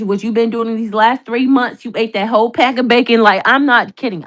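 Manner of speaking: ordinary talk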